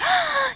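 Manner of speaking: sudden high gasp of dismay; a sharp intake of breath. Real, not acted.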